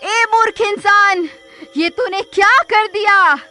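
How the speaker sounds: very high, wailing witch voice; agonized witch. Acted. Witch writhing under holy water, agonized wailing. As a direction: shriek in torment and disbelief. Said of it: "तभी वो चुड़ैल तड़पने लगती है".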